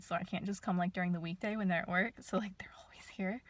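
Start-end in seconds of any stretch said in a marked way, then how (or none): 2.2-3.4 softer hushed whisper; nervous whisper. Real, not acted. Vlogger nervous near retired neighbours, whispered. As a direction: whisper so the neighbours can't hear.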